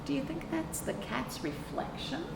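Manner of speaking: usual speaking voice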